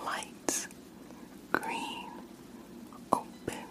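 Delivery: faint far-off whisper into one ear; distant test whisper; soft whisper. Shown as a demonstration. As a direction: breathe each word softly into the ear. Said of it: "me whispering in your ears like the last one".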